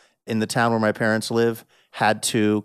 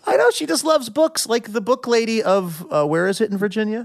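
high excited rush; 10 semitones higher than usual. Real, not acted. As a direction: lift it up eagerly. Excited tangent about mother.

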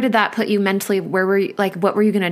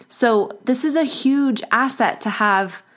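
normal talking voice

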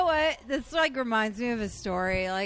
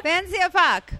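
a high, cheeky Irish-tinged pickup line; Irish accent; 8 semitones higher, 5 dB louder than usual. Retold or imitated. Friend repeating Colin Farrell's line, lightly Irish-accented. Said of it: "try it with an accent".

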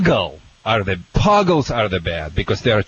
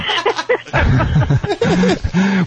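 long, high-pitched burst of laughter; laughing fit. Real, not acted. Dog trainer losing his thread on air, high laughing fit. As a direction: break up laughing, pitched well up. Host breaks into laughter mid-segment.

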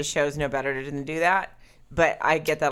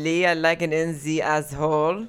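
badly faked German, sliding into French; playful mock German with French tinge; Frenchy German accent. Shown as a demonstration. Finishing the German demo for Lee, Frenchy mock accent. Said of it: "it's a little Frenchy German".

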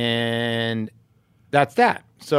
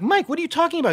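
much higher, baffled protest; incredulous; much faster than usual. Retold or imitated. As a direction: throw the question back at him in disbelief. Retold confrontation with neighbor Mike.

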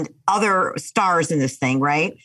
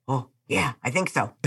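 soft lower stunned oh yeah; a mock dazed mumble; a hint of dazed; dazed quiet reply; 5 semitones lower, 6 dB quieter than usual. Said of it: "I go".

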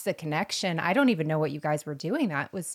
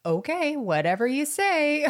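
higher, knowing sing-song brush-off; sarcastic humoring; 8 semitones higher than usual. Retold or imitated. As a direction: humor him like a clueless guesser. Humoring husband's wrong guesses.

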